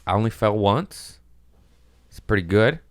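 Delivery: usual speaking voice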